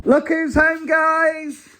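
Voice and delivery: high, excited welcome home; welcoming someone home. Real, not acted. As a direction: greet them happily as they come in.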